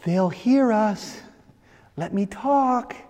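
slow soft hushed plea. Retold or imitated. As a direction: keep it down so the guards don't hear. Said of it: "as if to say".

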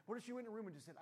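normal talking voice

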